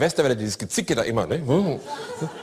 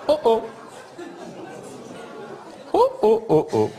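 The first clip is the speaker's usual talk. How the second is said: mock alarm; 8 semitones higher than usual. Merkel watching partner sink.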